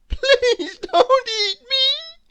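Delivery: high, slow tearful worm plea; pleading. A narrator's reading. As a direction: beg the bird, crying. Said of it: "pleaded Walter".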